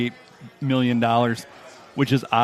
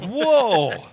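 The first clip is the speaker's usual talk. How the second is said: high, drawn-out whoa; mock amazement; 9 semitones higher, 3 dB louder than usual. Host voicing people hearing the theory, mock amazed. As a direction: imitate a stunned crowd reacting. Acts out people's stunned reaction.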